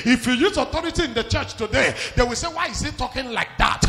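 loud, driving sermon at full volume; full-force preaching. Real, not acted. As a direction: thunder it out to the congregation.

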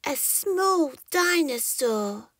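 small creature naming himself; little dinosaur voice. A narrator's reading. Squirt introduces himself.

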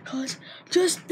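ordinary talk, nothing marked

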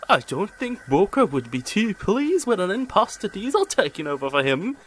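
mocking. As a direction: rub it in. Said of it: "finished Bill".

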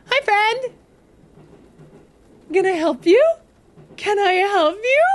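high, loud, nervously polite hail; mock-friendly greeting. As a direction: call out sweetly to a creepy stranger. Greeting a creepy game figure.

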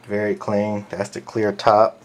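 ordinary delivery

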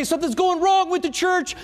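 high, fast whiny complaining voice; mock whining. Preacher mocks a whiner who wanted to quit, high whiny complaint. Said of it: "I didn't whine about it".

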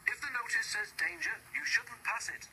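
other engines chorus. A narrator's reading. Engines warning sixteen, in high chiding voices. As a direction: scold the stubborn engine in a high sing-song. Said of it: "they said".

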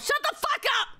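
very high squealed yell; indignant shriek. Real, not acted. Streamer silencing a teasing chat message, shrieking.

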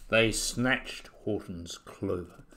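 ordinary delivery, nothing marked

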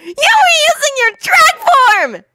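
high-pitched heated accusation; indignant high protest; hot retort. A narrator's reading. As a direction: accuse hotly in a squeaky boy voice. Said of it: "Naruto replied hotly".

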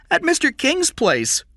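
put-on very high, loud child's voice; frightened boy. A narrator's reading. Captive boy answers the interrogation, frightened. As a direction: answer in a high boyish pitch.

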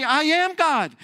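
high, loud proclamation; emphatic declaration. Acted. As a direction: declare it for all to hear. Voices Jesus declaring himself.

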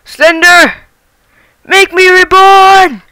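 loud high dramatic yell; hollering wildly at the monster, slow; panicking gamer. Real, not acted. Yelling at Slender in game.

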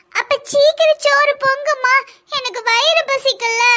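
put-on high, drawn-out child whine; hungry, pleading child. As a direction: pester mum for food like a little kid. Hungry child demands food.

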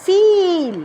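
ফিল with a stretched ee; long ee. Shown as a demonstration. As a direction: draw out the ee in ফিল. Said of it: "সাউন্ডটা elongated". (লম্বা ই দিয়ে ফিল)

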